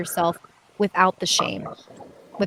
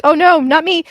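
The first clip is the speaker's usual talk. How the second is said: mock protest; 11 semitones higher, 9 dB louder than usual. Host imitates Ron declining.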